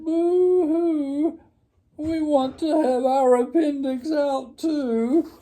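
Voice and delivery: high, loud mock wailing; wailing little girls. A narrator's reading. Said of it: "all the little girls cried".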